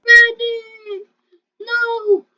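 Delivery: loud high wail of the name; anguished cry. Real, not acted. Player crying out as Freddy dies, anguished wail. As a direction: cry out as your friend dies.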